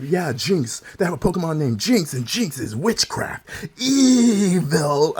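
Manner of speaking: slow ominous mock warning; mocking the parents. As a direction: draw out the final evil. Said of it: "they're like".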